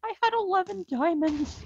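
high, slow wail of loss; dismayed lament. Real, not acted. Player lost diamonds off the bridge, dismayed lament.

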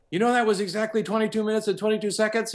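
high, fast, amazed report; excited engineer. Retold or imitated. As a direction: rattle it off in wonder. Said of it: "he goes".

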